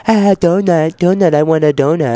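put-on sing-song taunt like a toddler; mocking the teasers.

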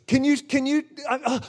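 ordinary delivery

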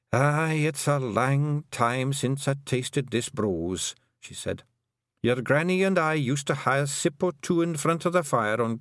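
put-on high, Scots-accented old Pictsie matriarch; a tiny Scottish clan mother, proud and fond; old Kelder voice. The Kelder telling Tiffany about her sons, high and Scottish.

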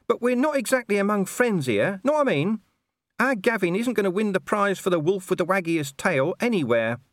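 high-pitched whiny talking-dog voice; whining. A narrator's reading. Gaspode warns that the wolf pack is unfriendly, whining. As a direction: keep up a high, wheedling whine. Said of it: "whined Gaspode".

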